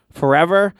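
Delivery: "Forever" lifted high into a question. Retold or imitated. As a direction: say "Forever" with a clear rising tune. Retold wife's questioning line.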